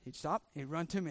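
ordinary talk, nothing marked

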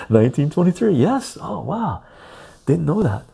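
high, loud surprise; real astonishment; surprised. Real, not acted. Surprised at the fact.

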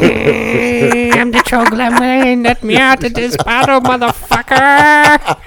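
high, slow squeaky troll. Pretend. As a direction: whine to be let out like a trapped troll. S2 voices trapped troll.

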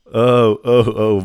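loud refreshed gasps after a drink. Real, not acted. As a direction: exclaim with relief after drinking. Reacting after gulping water.

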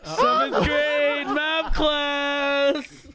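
slow, loud announcing; loud announcement. Acted. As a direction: call out the scene slowly. Announcing school roleplay.

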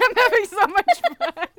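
laughing, high and loud. Real, not acted. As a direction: giggle it out loud.

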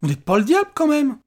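high, loud alarmed question; startled cry. A narrator's reading. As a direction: cry it out while backing away. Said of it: "Johan s'écria en reculant".

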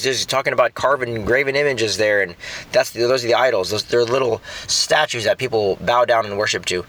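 ordinary delivery

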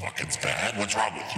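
demonic voice. Pretend. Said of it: "you went for demon vampire party boy".

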